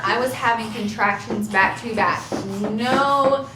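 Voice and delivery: usual speaking voice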